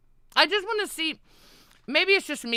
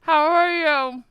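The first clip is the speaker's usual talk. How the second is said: cheeks stuffed full; 7 semitones higher, 5 dB louder than usual. Real, not acted. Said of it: "mouth stuffed with marshmallows".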